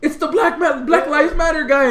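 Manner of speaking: high loud laughing call; excited recognition. Retold or imitated. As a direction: recognise him mid-sentence. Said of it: "he's like".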